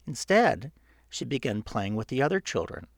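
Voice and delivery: plain reading voice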